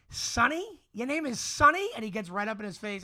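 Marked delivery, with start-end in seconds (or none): 0.1-1.9 higher, louder incredulous Sonny; dad mocking the mobster's name; mocking disbelief. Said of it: "my dad looks at him and goes".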